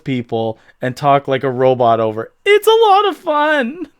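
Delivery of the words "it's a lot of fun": high-pitched scientist voice. Acted. [name], high character voice.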